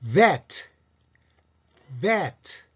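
vet opening on a buzzing teeth-on-lip v; touch teeth to lip and pronounce vet crisply; English V. Shown as a demonstration. Teacher modelling the quiz answer, clear English vet. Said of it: "上の歯を唇に軽く添えて".

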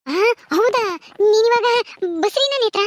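high, rising disbelief; astonished question. Acted. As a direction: react to unexpected baby news, pitch jumping up. Learns of Netra's pregnancy.

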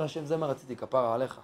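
low, hushed surrender to God; tearful prayer, understated. Acted. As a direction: murmur it softly as acceptance. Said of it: "אתה בוכה לשם". (תפילה שקטה ונכנעת)

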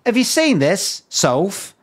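mock TV host pitching up in disbelief; imitating an incredulous presenter. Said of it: "saying that now and again".

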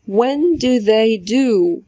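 first "do" reduced to d, second "do" full and long; slow model: "when d' they do"; weak then strong do. Shown as a demonstration. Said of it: "الأولانية د، التانية do". (الـ do الأولى مختصرة د والتانية طويلة)

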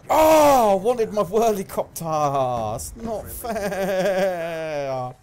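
high, loud, desperate no no no, dragging the last words out; panicked wailing. Real, not acted. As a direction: plead for your life, then whine slowly. About to be killed in game.

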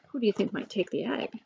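ordinary delivery, nothing marked

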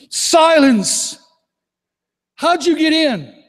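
loud, high rebuke then slow demand; stern shouted exorcist command. Retold or imitated. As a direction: order it to be silent and answer. Rebuking demons, casting out.

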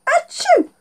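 high hammed-up sneeze; fake sneeze. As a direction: sneeze big for the kids. Fake sneeze knocks glasses off.

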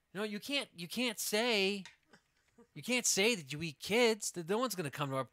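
a park ranger pulling them up short; park ranger scolding the bears in mock alarm.

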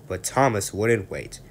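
ordinary delivery

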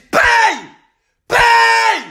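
high, shouted order to pay; angry demand. Acted. Gambler demanding winnings from the tobacconist, angry shout. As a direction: yell for your money.

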